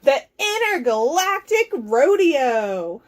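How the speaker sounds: slow, drawn-out announcer call; rodeo announcer. Acted. Announcing the aliens' big rodeo, announcer call. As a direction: stretch it out like an arena announcer.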